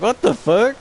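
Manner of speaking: high, loud stunned what-the; shocked disbelief in one breath; incredulous. Retold or imitated. Reacting to Short Round cameo.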